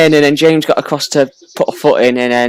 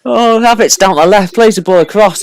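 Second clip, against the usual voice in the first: excited commentary; 7 semitones higher, 6 dB louder than usual. Real, not acted. Live commentary on attack.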